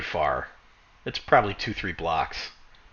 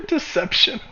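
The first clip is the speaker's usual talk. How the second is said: high-pitched chortled word; squeaky, giggly aside; chortling; 13 semitones higher than usual. Acted. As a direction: say it through a little chuckle. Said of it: "You just chortle a little bit".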